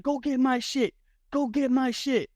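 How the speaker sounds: high mock barked order; imitating Chucky. Rival fighter ordering his security, high mock bark. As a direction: order them around in a squeaky rage.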